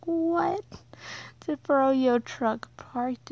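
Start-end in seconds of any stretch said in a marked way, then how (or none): 0.1-0.6 much higher, sharp surprised what; a stunned yelp of confusion; retold disbelief. Hearing it's only a Froyo truck, a high surprised cry. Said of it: "And I'm like".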